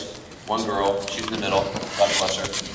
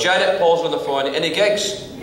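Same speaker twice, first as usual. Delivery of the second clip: higher, louder Scottish shout to the wife; Scottish dad calling out; 8 semitones higher, 5 dB louder than usual. Retold or imitated. Father calling to wife Janet, eager Scottish voice. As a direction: call over to Janet, eager.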